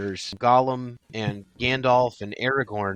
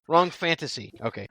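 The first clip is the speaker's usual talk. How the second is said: ordinary talk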